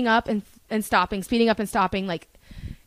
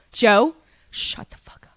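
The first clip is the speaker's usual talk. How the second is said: slow, whispered retold warning; acted-out hissed whisper; hushed warning. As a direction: whisper it urgently to a friend in trouble. Privately silencing Joe before the cops.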